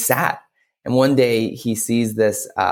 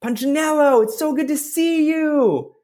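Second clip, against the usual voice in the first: wood carver. Acted. Wood carver calls to Punchinello at the door, high gushing welcome. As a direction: greet him in a big high storybook voice. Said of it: "the wood carver is like".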